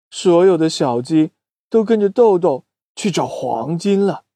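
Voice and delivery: ordinary delivery, nothing marked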